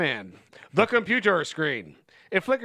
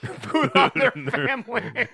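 laughing; 4 semitones higher, slower than usual. Real, not acted.

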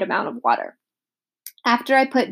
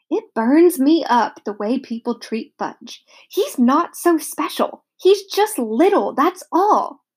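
mild grumble; 5 semitones higher, slower than usual. A narrator's reading. Peter resents brother's treatment.

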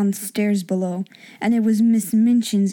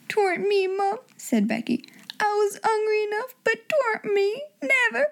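high tearful cockney plea; beg, near tears; pleading servant girl; 11 semitones higher than usual. A narrator's reading. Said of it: "said Becky".

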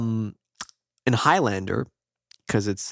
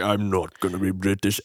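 Sean Connery impression; 4 semitones lower than usual. Host voicing the movie's stance, mock Scottish accent. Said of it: "But Connery isn't".

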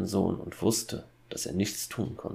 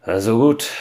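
much louder, raised voice; 4 semitones higher, 13 dB louder than usual. A narrator's reading. Hunter winding up talk with woodcutter, loud. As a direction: say it loudly and raised, for all to hear. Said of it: "sagte der Jäger laut".

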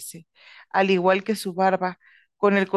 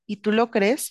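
ordinary delivery

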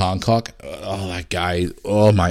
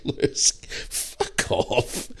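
laughing; 3 semitones higher, 7 dB quieter, much slower than usual. Real, not acted. Laughing at son's joke.

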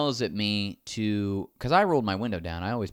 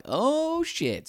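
startled oh. Retold or imitated. Retold sudden alarm.

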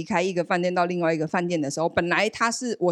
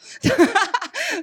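high laugh with a rising end; real laughter; 4 dB louder than usual. Real, not acted. Laughing hearing dad couldn't sleep for the pain. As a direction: laugh out loud at the coincidence.

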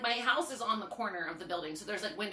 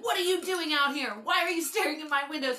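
higher, louder confrontation; confronting; 6 semitones higher, 5 dB louder than usual. Retold or imitated. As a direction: demand answers from the man outside. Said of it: "I was like".

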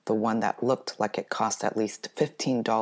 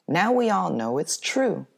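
much higher and louder mockery; gloating taunt; 8 semitones higher, 6 dB louder than usual. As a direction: rub it in with a sing-song jeer. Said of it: "she said when she passed me".

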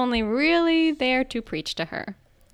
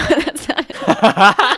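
ordinary delivery

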